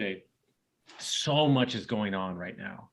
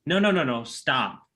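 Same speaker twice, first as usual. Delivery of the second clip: emphatic loud no's; 3 semitones higher, 5 dB louder than usual. Retold or imitated. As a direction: cut them off with a loud insistent no. Insisting people truly mean it.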